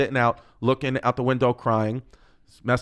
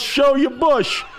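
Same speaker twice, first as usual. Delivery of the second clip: high, drawn-out holler; loud, laughing mock heckle; retold yell; 12 semitones higher, 4 dB louder, much slower than usual. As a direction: shout it like dad from the back row. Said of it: "He yells".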